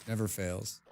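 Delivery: low, soft remark; understated grumble; muttered aside. Real, not acted. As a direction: say it quietly to yourself. First muttered take, then repeated big.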